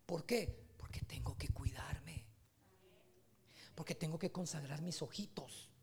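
slow confiding whisper. Acted. Pastor confiding to the congregation why he guards his eyes, whisper. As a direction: whisper it like a secret to the flock.

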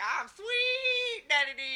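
high, loud, slow puppet pimp. Retold or imitated. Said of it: "He was like".

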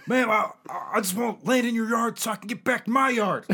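high, loud, stammered protest; flustered pleading. Acted. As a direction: plead loudly with the neighbour, half laughing. Acted quarrel with neighbour over wall.

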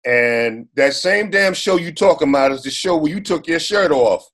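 usual speaking voice

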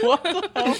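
high, slow, laughing disbelief; laughing surprise. Real, not acted. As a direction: squeal it in laughing shock. Reacting to spicy bean.

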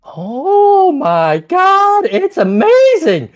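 very high, loud, slow delighted squeal; squeaky delighted head. Acted. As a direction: squeal with delight like a greedy monster. Head tastes the paper.

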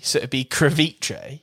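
"creviche" as "kreh-VEE-chay"; crevice like ceviche. Shown as a demonstration. Host demoing the swap, crevice like ceviche. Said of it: "pronounced like ceviche".

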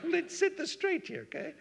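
high, hammed-up setting him straight; playful fake scolding; mock stern. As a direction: pretend to lay down the law. Mock correction after the joke.

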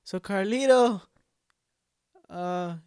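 high, hammed-up fake-casual question; Lalin, obviously fishing. Lalin wearing a wire probes Carlito, obvious fake innocence. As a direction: pitch it up, painfully obvious snooping. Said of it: "He's so obvious".

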